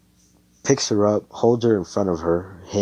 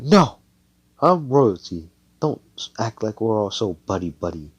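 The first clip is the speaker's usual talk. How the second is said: haughty refusal; 3 semitones higher, 5 dB louder than usual. Acted. Noelle to Asta acting friendly, haughty refusal. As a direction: shut them down, voice raised.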